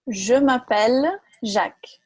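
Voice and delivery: slow, clear "Je m'appelle Jacques" for repeating; slow teacher model "Je m'appelle Jacques"; slow model phrase. Shown as a demonstration. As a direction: model sentence spoken slowly. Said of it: "teacher drilling phrases".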